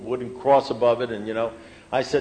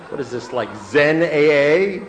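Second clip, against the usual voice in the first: slow, incredulous question swelling louder; mock disbelief; 3 semitones higher, 8 dB louder, slower than usual. Man reacts to sponsor's cryptic answer, mock disbelief. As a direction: stretch it out, baffled and scoffing.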